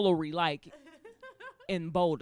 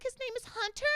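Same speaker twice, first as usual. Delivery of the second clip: very high, put-on sweet reply; dog owner voice; 13 semitones higher, 4 dB quieter than usual. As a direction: lift it into a high, dainty voice. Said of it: "She's like".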